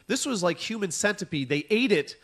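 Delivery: ordinary talk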